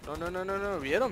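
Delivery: ordinary talk, nothing marked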